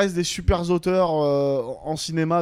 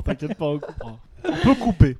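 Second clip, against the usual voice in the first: laughing. Real, not acted. Laughing after the running gag.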